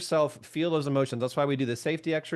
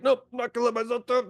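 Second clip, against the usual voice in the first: pitched up high and quick, a hammed-up "nope"; mock stubborn refusal; 8 semitones higher, faster than usual. To the listeners, voicing someone refusing to throw up, mock stubborn. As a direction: play the stubborn gut saying no, a bit fast.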